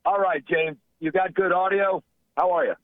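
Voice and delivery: speakerphone audio; talking over a speakerphone. Real, not acted. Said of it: "You sound like you're on speakerphone".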